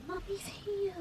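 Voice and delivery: high, whispered comfort to a pet; soothing whisper. Real, not acted. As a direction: breathe it softly to a scared dog. Soothing her shaking dog.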